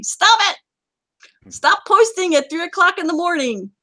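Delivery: high-pitched exasperated order; mock scolding. Host scolding players posting at night, mock scolding. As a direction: tell them off in playful outrage.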